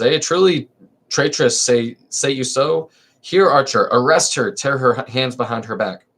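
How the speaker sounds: lively theatrical reading of a verse comedy; dramatic play reading. Acted. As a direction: read the chorus lines with stage energy. Reads magistrate.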